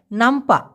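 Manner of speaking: নামপা said clearly and short, no h at the end; careful pronunciation. Shown as a demonstration. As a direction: repeat নামপা cleanly for learners. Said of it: "আমি আবার উচ্চারণ করতেছি".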